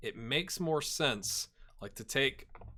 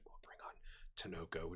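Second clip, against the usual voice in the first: slow hushed whisper; softer, slow whispered aside; 9 semitones lower, 17 dB quieter than usual. Real, not acted. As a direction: whisper it like a secret. Aside about the substitution.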